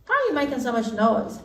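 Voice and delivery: high squeaky frog; high frog calling from the well. Acted. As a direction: pipe up as the curious frog. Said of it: "And someone said".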